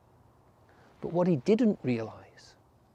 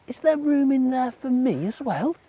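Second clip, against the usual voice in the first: a cold little rabbit hoping to come in; 8 semitones higher, 5 dB louder than usual. A narrator's reading.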